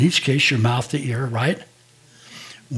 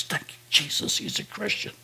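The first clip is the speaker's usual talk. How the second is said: whispered thanks, half to herself; relieved whisper; 8 semitones higher, 9 dB quieter than usual. Retold or imitated. The sister, relieved her brother is a believer, whispering. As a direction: breathe it out, rising on the last word.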